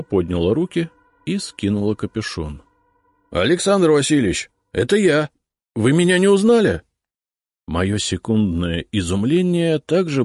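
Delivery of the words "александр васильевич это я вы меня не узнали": high, loud eager cry; excited. A narrator's reading. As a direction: burst out, eager to be known. Excited reporter unmasks himself.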